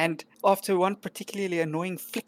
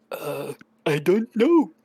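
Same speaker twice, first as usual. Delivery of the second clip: goblin voice; 4 dB louder, slower than usual. Acted. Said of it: "he goes".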